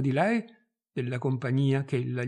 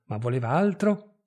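plain reading voice

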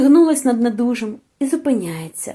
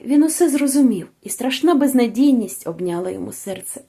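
ordinary delivery, nothing marked

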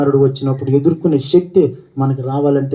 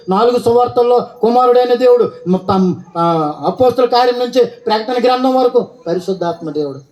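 raised-pitch emphatic preacher; preaching; 6 semitones higher than usual. Acted. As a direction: drive each phrase home from the pulpit.

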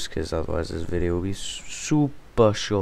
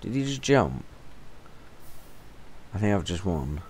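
normal talking voice

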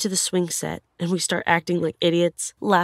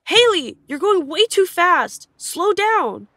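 high, loud, stretched call to a runner; 12 semitones higher, 4 dB louder, slower than usual. Retold or imitated. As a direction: imitate friends calling after someone too far ahead. Friends call after the runner.

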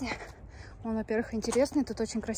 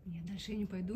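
hushed; 16 dB quieter than usual. Real, not acted. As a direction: keep it hushed and wary. Hushed talk in dark tunnel.

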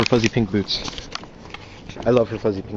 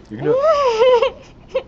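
much higher, slow, stretched cheering; high drawn-out cheer; much slower than usual. Real, not acted. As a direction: sing it out high and long to egg her on. Cheering on friend before challenge.

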